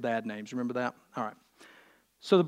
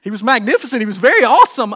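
higher, louder imitation of gushing; mock excitement; 7 semitones higher, 14 dB louder, faster than usual. Wife praises visitor to husband, mock gushing. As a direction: play up the wife's starry-eyed praise. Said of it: "his wife comes extremely excited".

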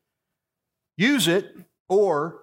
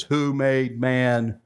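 low, very slow, restrained anger; frustrated patience, drawn out low and slow; a touch of stern, measured anger; a little angry; 5 semitones lower, much slower than usual. Retold or imitated. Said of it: "He was getting a little angry".

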